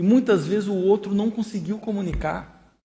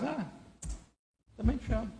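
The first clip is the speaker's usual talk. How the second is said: much softer, a flat dismissive mumble; bored indifference; 5 semitones higher, 13 dB quieter than usual. Acted. Husband brushing off his wife's I love you, uninterested. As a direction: toss it off like you couldn't care less. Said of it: "foi de desinteresse, foi de descaso".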